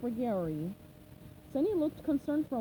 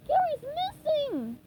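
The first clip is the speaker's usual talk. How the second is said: much higher and slow, upset cry; distraught; 5 dB louder, slower than usual. Acted. SpongeBob telling Squidward his snail is gone, distraught. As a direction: blurt out the bad news, upset. Urgent news.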